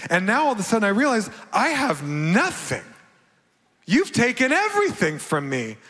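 climbing in pitch as the losses pile up; rising distress. Retold or imitated. Recalls panic at losing everything.

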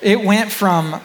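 normal talking voice

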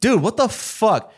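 high, slow rowdy bro outburst. Pretend. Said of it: "Pretend, pretend I just farted".

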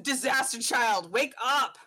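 blunt, unshouted wake-up; not screamed. Acted. Dad bursting in on his son, blunt not screaming. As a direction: say it flat and pointed, no yell. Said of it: "not screamed, but just".

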